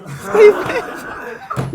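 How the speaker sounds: breathless laughing squeal; mock the late answer laughing. Real, not acted. Host mocks late answer.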